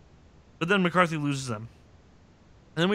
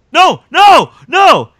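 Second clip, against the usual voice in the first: very high, loud yells; mock anguished shout; 13 semitones higher, 18 dB louder, much slower than usual. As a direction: cry out in hammed-up protest. Acting out anguished protest.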